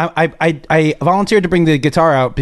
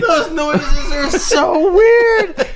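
laughing; 9 semitones higher, slower than usual. Real, not acted. Laughing so hard stomach hurts.

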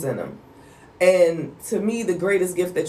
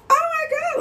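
awestruck. Retold or imitated. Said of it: "I was just like".

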